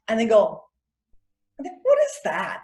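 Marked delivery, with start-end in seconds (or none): 1.6-2.6 very high, drawn-out baffled question; mock disbelief. Mimicking people asked to smile, mock disbelief. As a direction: squeak it slowly, as if appalled.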